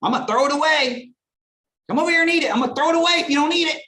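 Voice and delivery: high, loud shrill-free yell across the shop; hammed-up yelling at a kid; mock yelling mother. Mother in ice cream shop yelling at her kid, mock shout. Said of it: "just yelling for him".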